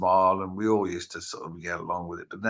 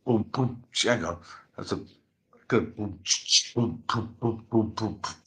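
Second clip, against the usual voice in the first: beatbox. Pretend. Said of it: "we need your best [name], a freestyle beat".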